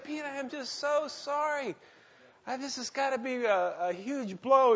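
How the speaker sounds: high-pitched exaggerated sorrow; preacher consoling his brother in a high voice; mock sympathy. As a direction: play yourself overdoing sympathy. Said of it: "I said".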